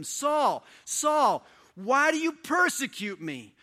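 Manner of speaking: loud, high calling voice from above; voice from heaven. A narrator's reading. Voice from heaven calling Saul, loud and high. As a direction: call out like a voice from heaven. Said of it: "heard a voice say to him".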